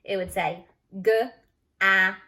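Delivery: g-at stretched slow, short a; sounding out short a. Shown as a demonstration. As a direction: drag out g-at sound by sound. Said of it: "If we didn't put the E".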